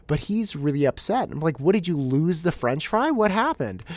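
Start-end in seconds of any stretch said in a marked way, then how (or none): 1.6-3.8 concerned questioning. Retold or imitated. Dad questions upset son.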